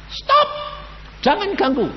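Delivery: high shouted command to stop; retold shout. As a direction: yell it to stop the gunfire. Man yelling to stop firing.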